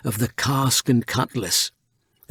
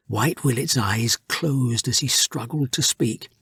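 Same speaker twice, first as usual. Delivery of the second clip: plain reading voice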